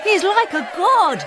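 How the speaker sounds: a happy crowd hailing the hero; crowd cheering. Acted. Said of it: "they cried".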